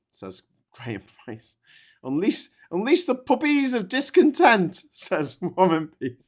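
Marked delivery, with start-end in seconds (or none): 2.0-4.8 high, loud hammy war cry; mock battle cry. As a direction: shout it like rallying troops. Reads comment as battle cry.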